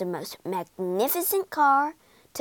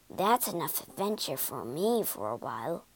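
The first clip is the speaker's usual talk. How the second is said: quiet thought; 5 semitones lower, 7 dB quieter than usual. A narrator's reading. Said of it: "thought Mole".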